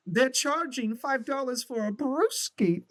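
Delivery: high, slow laughing bro voice at a party. Retold or imitated. Hammed-up high laughing bro. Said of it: "he's like".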